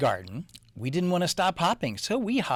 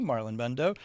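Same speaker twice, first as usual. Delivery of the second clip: ordinary delivery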